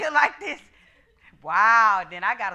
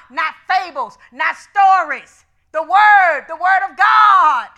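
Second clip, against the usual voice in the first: emphatic preaching; 3 semitones higher, 12 dB louder, slower than usual. Acted.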